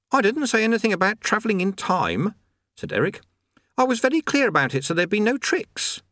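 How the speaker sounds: put-on high young boy's voice; lightly high-pitched youth voice; boy Eric. Eric protests.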